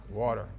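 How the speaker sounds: "Water" said low as a plain statement, rounded "waw" vowel; his own WATER. Retold or imitated. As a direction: repeat "Water" low and even, as your order. Repeats the order.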